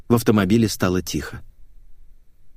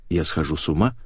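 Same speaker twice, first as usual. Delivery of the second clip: usual speaking voice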